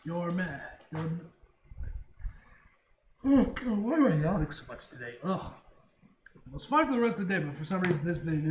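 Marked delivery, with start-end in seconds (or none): none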